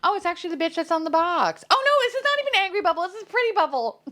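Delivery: high, fast laughing squeal; excited realisation. Real, not acted. Collector realising it is Pretty Bubble, excited squeal. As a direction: blurt it high and quickly.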